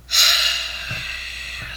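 long exhaled sigh; exasperated, drawn-out sigh; weary sigh. Real, not acted. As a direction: let out a long breath of resignation. Dreading finishing the review.

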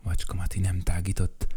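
ordinary delivery, nothing marked

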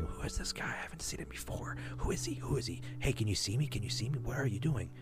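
imitated voices in the head, whispered. Retelling the other voices heard while tripping, whispered. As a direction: whisper like a crowd of unseen strangers. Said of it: "I kept hearing".

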